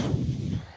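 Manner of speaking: long, heavy exhale, acted out; deep breath. Dad whose wife was waiting, deep patience-gathering sigh. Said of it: "I took a deep breath".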